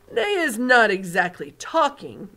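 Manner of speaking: big friendly giant showing off his hearing; booming giant. Acted. BFG voice again.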